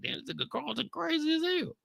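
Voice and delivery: mock commentary in falsetto; high mock voice.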